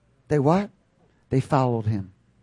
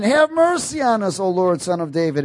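high, loud plea that falls away; crying out harder; 6 semitones higher, 5 dB louder than usual. Acted. Blind men crying out all the more, high urgent plea. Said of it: "they cried out all the more".

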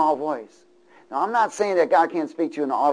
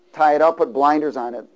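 ordinary talk, nothing marked

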